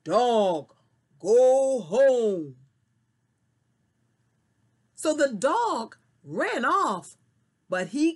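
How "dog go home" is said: a kid's lightly voiced grown man, firm; bossy order, like a grown-up man shooing a dog; pretend man's voice. Squirrel pretending to be a man shouts at the dog. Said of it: "And he said, ... pretended to be a man".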